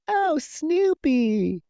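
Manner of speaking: put-on very high, drawn-out child's cry; imitated child voice. Child in the bedroom after the gunshot, mimicked high voice. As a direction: stretch it out high, mimicking a little kid.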